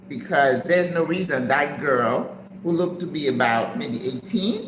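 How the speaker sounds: usual speaking voice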